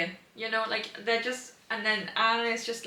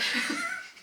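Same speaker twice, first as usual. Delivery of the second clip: giggle. Real, not acted. Laughing at the book scene.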